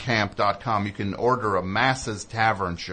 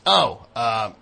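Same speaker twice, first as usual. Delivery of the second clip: monster voice; 3 semitones higher, 6 dB louder, much slower than usual. Retold or imitated. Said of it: "this monster goes".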